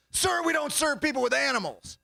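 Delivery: high-pitched officious refusal; imitated shop worker. Shop worker refusing man with a dog, high mimicry. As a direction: pitch it up as a fussy clerk.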